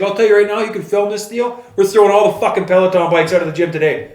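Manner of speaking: bang out the vow; retold anger. Dana telling Theo on camera, fired up. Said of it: "He was mad".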